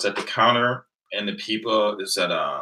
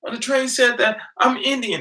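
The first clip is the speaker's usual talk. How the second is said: much higher and louder, spelled-out laughter into excited news; laughing; 9 dB louder than usual. Retold or imitated. Telling mom a stranger called him Indian, laughing. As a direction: cackle it out, then blurt the news with a laugh still in it. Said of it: "I went home laughing".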